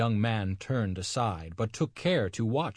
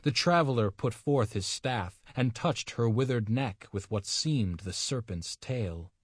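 ordinary delivery, nothing marked

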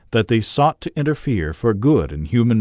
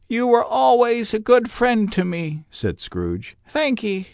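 put-on much higher, begging old man; pleading Scrooge; 13 semitones higher than usual. A narrator's reading. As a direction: beg him not to be harsh. Scrooge grateful, high.

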